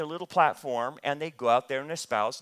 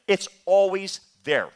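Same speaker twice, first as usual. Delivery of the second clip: emphatic; 4 semitones higher, 8 dB louder, slower than usual. Real, not acted. Preaching climax.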